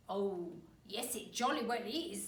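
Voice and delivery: put-on loud, knowing old man; sly husband. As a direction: say it with a smug edge. Husband taunts wife.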